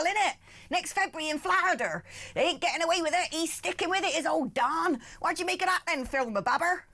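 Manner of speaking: put-on host opens the Trump story in a West Country accent. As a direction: lay on a thick West Country accent for the news. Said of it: "I could actually quite happily try and do the rest of the show like that".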